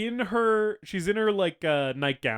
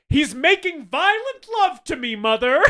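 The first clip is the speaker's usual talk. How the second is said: much higher, louder quip through a laugh; laughing teasing; 12 semitones higher, 9 dB louder than usual. Retold or imitated. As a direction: tease your mother while laughing. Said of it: "And she goes".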